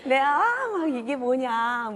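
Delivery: loud, high mock scolding; hammed-up angry mother shouting; mock yelling mom. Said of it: "막 소리 지르고".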